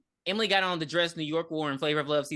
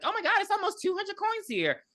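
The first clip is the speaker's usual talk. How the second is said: much higher, gushing amazement; excited girl voice; 14 semitones higher than usual. Retold or imitated. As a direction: squeal it like a thrilled girl. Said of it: "She's like".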